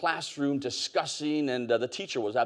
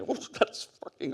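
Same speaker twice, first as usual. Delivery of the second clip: whispered aside; 4 semitones higher, 6 dB quieter, slower than usual. Retold or imitated. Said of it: "And I".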